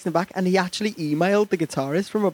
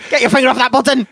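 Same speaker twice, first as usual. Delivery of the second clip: high, loud shout; mock urgent order; 8 semitones higher, 5 dB louder, faster than usual. Radio host tells listeners not to switch off, mock urgent shout. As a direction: shout it as a playful command.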